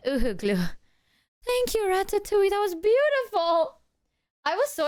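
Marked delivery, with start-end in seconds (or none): 1.4-3.8 gushing thanks. Real, not acted. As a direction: gush over the gift.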